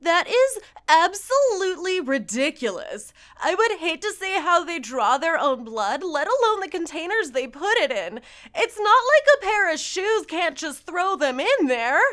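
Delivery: loud indignant read; huffy outraged rant; mock outrage. Mock outraged reading of comment.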